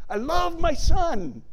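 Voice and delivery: high, loud and slow, proclaiming as God. Acted. Preacher voicing God the Father, slow and loud. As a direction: boom it out like God declaring his love. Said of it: "He continued repeating and repeating".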